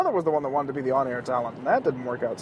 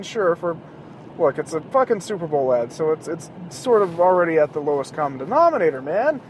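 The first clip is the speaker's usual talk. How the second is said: ordinary talk, nothing marked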